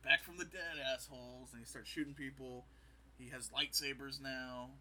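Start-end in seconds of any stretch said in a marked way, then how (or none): 0.0-1.5 loud mock battle cry; hammed-up shout of a returning villain; Boba Fett shout. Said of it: "he's like".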